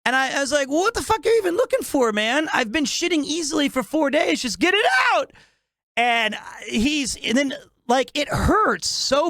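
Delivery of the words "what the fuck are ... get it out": fast, high, exasperated rant; hammed-up frantic complaint; rapid exasperated rant. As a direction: rattle it off fast and fed up.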